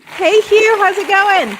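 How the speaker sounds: loud excited greeting. Real, not acted. Calling out a greeting to guest.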